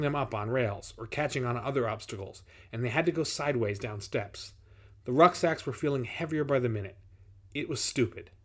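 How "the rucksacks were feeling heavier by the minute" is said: plain reading voice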